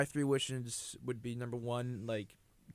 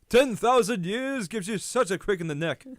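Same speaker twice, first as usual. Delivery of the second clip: louder, higher cartoon genie; genie impression; 3 semitones higher, 8 dB louder than usual. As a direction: stiff ancient genie griping. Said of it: "that was good".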